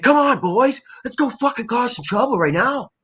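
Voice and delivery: put-on high-pitched, rowdy coast-accent voice; rally the boys in a high, excited voice; Mike Smith impression. Friend imitating an actor rallying the boys for trouble, high rowdy impression. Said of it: "that's how he sounds".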